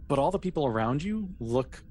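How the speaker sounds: usual speaking voice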